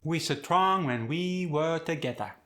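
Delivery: Khmer-accented, "strong" as suh-trong. Shown as a demonstration. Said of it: "we'll have [name] say it".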